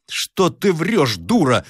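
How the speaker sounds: high, drawn-out scolding without much volume; indignant cry. Acted. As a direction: scold her as an outraged servant. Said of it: "закричал Егор". (возмущённый окрик слуги)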